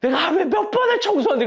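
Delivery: high, laughing protest as the wife; a wife protesting how much she cleans, voiced high and laughing; mock wife protest. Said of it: "그러니까 아내가".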